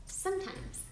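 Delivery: usual speaking voice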